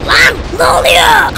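scared gamer yelling at the screen; panicked shout. Real, not acted. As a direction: cry out in fear. Panic as something happens.

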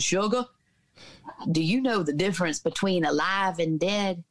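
a hint of Dad's big Southern voice; big Southern booming voice. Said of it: "in that big Southern booming voice".

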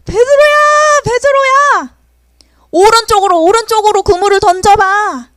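high, loud call across the water; Jesus calling Peter from the lakeshore. Acted. Risen Jesus calls from shore.

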